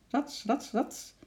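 high, spoken "rats" swishes; swishing leap noises. A narrator's reading. Chimp racing off over the grass, high swishing noises. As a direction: voice quick bounds as sound effects.

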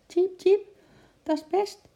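high, squeaky agreeing chirp; high bird chirp. A narrator's reading. Eki the bird chirps his agreement to Sjimp. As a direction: chirp it out brightly. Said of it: "tjirpte Eki".

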